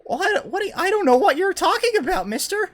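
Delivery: put-on very high, loud, fast innocent kid voice, laughing; fake little boy voice. Caught gnome denies the makeup in a high, laughing boy voice. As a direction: play dumb as a squeaky child. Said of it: "He goes".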